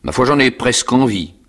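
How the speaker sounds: mock menace. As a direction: relish scaring him. Convict threatening to eat cheeks.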